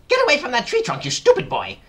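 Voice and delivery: loud, high angry scolding; Aunt Spiker yelling. A narrator's reading. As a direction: yell a furious warning at a child. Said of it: "Aunt Spiker yelled".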